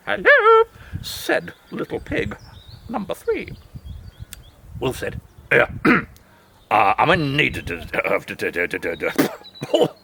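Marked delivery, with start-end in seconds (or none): 0.1-0.6 high, loud bright greeting; a piglet chirping hello; high little pig. Acted. Third pig greeting wolf. 5.5-9.9 deep, fast-talking wolf; wolf bluffing his way in; low wolf voice. Acted. Wolf demanding dessert.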